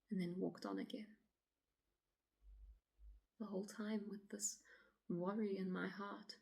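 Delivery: quiet, halting dramatic monologue, long pauses; troubled woman recounting. Acted. Woman telling officer of walking alone at dusk, uneasy and hushed. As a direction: relive it softly, leaving silences between phrases.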